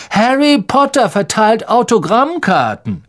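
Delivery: high, loud mocking announcement; booming taunt. Malfoy mocking Harry before the crowd, loud. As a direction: proclaim it to mock him. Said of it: "dröhnte Malfoy".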